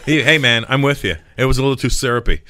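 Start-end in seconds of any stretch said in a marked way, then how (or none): none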